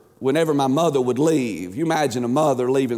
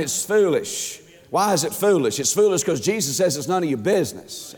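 ordinary talk

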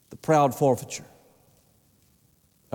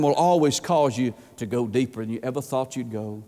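ordinary delivery

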